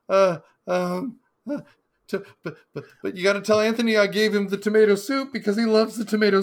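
high, loud, stammering panicked impression; mock nervous breakdown. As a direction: imitate a man cracking up, loud and high-pitched. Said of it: "a forty-year-old man having a nervous breakdown".

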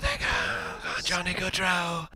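soft whispered rumor voice; whispering. Pretend. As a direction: breathe it like a secret.

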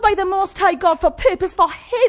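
loud, high preaching; fiery revolutionary speech. Acted. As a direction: proclaim it with full force. Rising preaching at full force.

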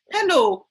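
high, model "Hello" without any throat rasp; proper English H. Shown as a demonstration. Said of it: "Do it properly".

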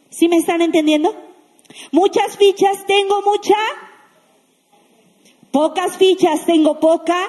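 ordinary talk

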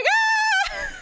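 very high, loud shriek; mock panicked scream. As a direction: let out a hammed-up terrified scream. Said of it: "And he's like".

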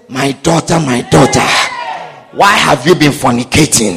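deep, grave divine rebuke; voice of God. Pretend. God confronting a sinning daughter, deep and grave. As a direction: speak low and grave as God.

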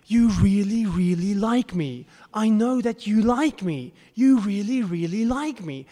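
gushing Oscar speech. Retold or imitated. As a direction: gush like a thrilled winner clutching her Oscar. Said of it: "And she says".

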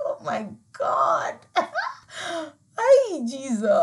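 breathless, slow laughter; can't get the words out for laughing; real laughing fit. Real, not acted. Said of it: "I'm so sorry".

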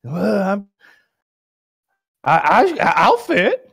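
stammering, high-pitched put-on mumble; hesitant, halting movie-star impression; wobbly high impersonation of a star; mock Marlon Brando. Acts out Brando replying to crew.

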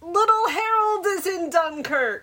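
high, loud gleeful exclamation; delighted high-pitched announcement; excited. Real, not acted. Teasing announcement to co-host.

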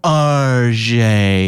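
"R J" drawn out as "ar-zhay"; affected correction. Retold or imitated. Mimicking the registry lady correcting a misheard name, affected pronunciation. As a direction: linger on "R J", softening the J to zh. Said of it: "You misheard me, sir".

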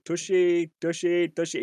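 a bad mock French accent, shouting across the water; terrible French accent. A player mimicking a French rival calling Tash, in a bad French accent. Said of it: "I'm gonna do a terrible French accent".

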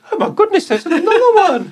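high, shocked posh exclamation; posh gentleman gasp. Acted. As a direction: gasp like a startled old gentleman. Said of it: "Sir Cecil's like".

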